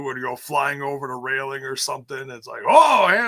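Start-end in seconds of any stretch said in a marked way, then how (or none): 2.7-3.3 loud, higher startled call-out; mock alarm. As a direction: yelp a warning to bystanders. Acts out startled warning.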